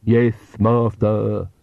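very slow, lisping henchman; ludicrous lisped servant drawl; ridiculous servant voice. Acted. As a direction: drag it out like a creepy vampire's servant. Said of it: "And the ridiculous voice".

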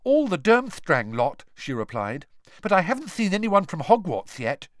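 put-on girl voice. A narrator's reading. Third-year girl voiced.

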